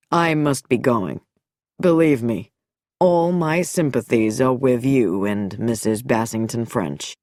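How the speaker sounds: low, smooth doctor's farewell; deep, polished, courteous. A narrator's reading. Nicholson's polite, lowered farewell.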